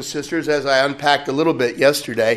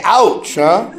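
wry ouch; 3 semitones higher, 4 dB louder than usual. Retold or imitated. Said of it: "We just say".

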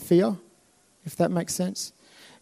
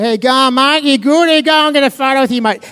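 loud, higher broad Australian greeting; hammed-up Australian tourist bugging the guard; loud Aussie bloke; 6 semitones higher, 5 dB louder than usual. Aussie tourist greeting a guard, loud broad Australian. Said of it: "as Aussies do".

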